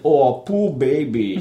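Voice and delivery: slow, mocking sarcasm; sarcastic. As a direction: drawl it like a sneer. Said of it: "I like sarcastic Schwarzenegger".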